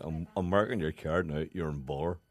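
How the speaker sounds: lightly imitated engineer issuing a warning; Ken. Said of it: "It's a pretty good impersonation".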